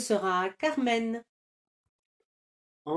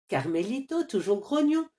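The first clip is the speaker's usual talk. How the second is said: usual speaking voice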